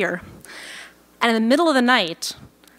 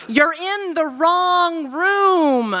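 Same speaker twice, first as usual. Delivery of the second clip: slow surfer-dude drawl on "you're in the wrong room"; Californian accent; 6 semitones higher, much slower than usual. Retold or imitated. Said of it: "with my best Californian accent".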